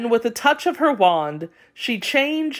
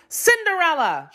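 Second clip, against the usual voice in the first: shouted call; 10 semitones higher than usual. A narrator's reading. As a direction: call her name up high and bossy. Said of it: "shouted the older stepsister".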